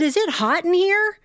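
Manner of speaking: mock casual. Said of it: "I looked out at the audience and I said".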